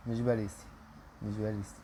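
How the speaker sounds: real disappointment; deflated sigh. Real, not acted. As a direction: let the hope drain out of it. Disappointed, not the wanted player.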